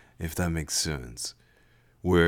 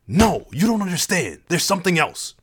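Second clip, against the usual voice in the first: higher, louder desperate shout; 11 semitones higher, 6 dB louder than usual. Acted. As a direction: cry out to be heard. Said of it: "Jax shouted".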